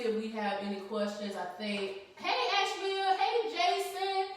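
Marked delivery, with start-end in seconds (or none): 2.2-4.3 high, singsong hellos to viewers; bright-pitched shout-out, names stretched out; calling out greetings. Real, not acted. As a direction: greet the audience in a lifted voice.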